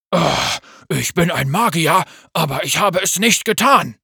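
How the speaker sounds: loud pained cry with a moan; screaming in pain. Acted. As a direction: force it out through sharp pain. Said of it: "Er schrie vor Schmerzen auf".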